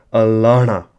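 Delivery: "alana" with a loud, pushed "la"; changed L tone. Shown as a demonstration. Teacher repeating so learners hear the tone, emphatic "alana". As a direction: lean firmly on the middle of "alana". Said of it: "so I'll say it again".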